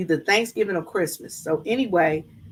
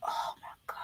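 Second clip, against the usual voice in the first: breathless whisper of wonder; hushed, reverent oh my God; awed whisper; 15 dB quieter than usual. Real, not acted. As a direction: whisper it, overcome by the beauty. Awed by his whistling.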